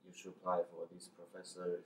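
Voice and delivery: ordinary delivery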